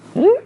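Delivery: high rising "hmm?"; dog's questioning hum. Retold or imitated. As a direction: imitate a puzzled dog with a squeaky upward hum. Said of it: "it's like when a dog".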